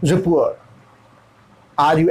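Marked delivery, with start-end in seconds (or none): 0.0-0.6 रिपोर्ट said as a rounded ruh-por; teacher models American रिपोर्ट; American rapport. Shown as a demonstration. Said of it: "American English के हिसाब से".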